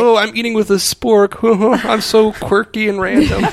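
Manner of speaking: hammed-up high voice with a laugh; mock quirky. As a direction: make fun of someone showing off quirkiness. Mocking people who act quirky.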